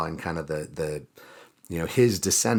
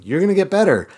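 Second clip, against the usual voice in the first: high, louder put-on chirp; mock reassurance; 13 semitones higher, 9 dB louder, faster than usual. Hazael tells the sick king he'll recover, mock-cheerful. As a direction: pitch it way up for falsely reassuring good news. Retold false good news to king.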